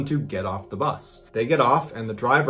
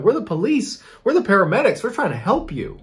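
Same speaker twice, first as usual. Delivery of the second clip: acted-out urgent reassurance, pitched higher; insistent pleading; 7 semitones higher than usual. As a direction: plead with someone who won't listen, voice raised in pitch. Acted officers pleading with injured woman.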